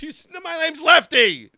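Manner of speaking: high, loud insistence; indignant protest. Acted. Insisting on his name.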